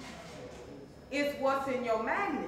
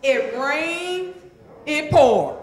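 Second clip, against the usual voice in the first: louder, punching each half; call-and-response shout; 3 semitones higher, 11 dB louder, much slower than usual. Shown as a demonstration. Coach leads the audience in the saying, louder. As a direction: belt the saying for the crowd. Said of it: "go on and say it with me".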